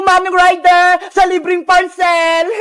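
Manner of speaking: usual speaking voice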